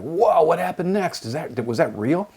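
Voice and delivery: wide-eyed, eager student voice; excited students. Retold or imitated. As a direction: gush like an amazed student. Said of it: "and they're like".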